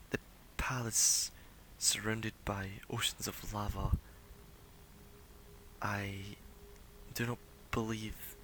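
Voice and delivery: soft, halting mystic, long pauses; lightly voiced weary seer. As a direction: weigh each phrase quietly, lightly in character. Game master voices a drained seer.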